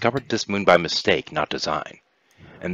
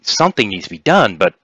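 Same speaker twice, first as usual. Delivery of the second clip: halting, higher-pitched alien envoy; hesitant Syntholo; 7 semitones higher, 7 dB louder than usual. Acted. Syntholo explains the unprotected world to players, hesitant. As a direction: explain a problem awkwardly, stumbling.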